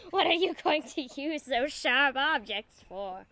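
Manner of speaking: high, loud mock-sinister meditation leader; hammed-up evil storyteller. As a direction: terrorize the children with a creepy guided meditation. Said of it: "Evil jazz voices in their dreams".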